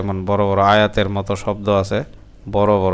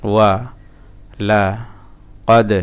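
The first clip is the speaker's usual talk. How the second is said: ওয়া, লা, কদ each on its own; broken-up syllables; 3 dB louder than usual. Shown as a demonstration. Teacher drilling learners, one syllable at a time. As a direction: leave a clear gap after each of ওয়া লা কদ. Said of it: "ভাঙা ভাঙা উচ্চারণ".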